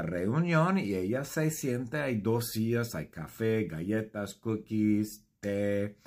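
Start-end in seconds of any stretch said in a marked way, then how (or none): none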